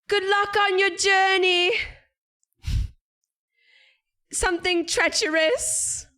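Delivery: put-on high, slow old woman shout; old lady yelling. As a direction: yell it like a shrill granny in a film. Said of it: "It's like an old lady and she's, like, yelling".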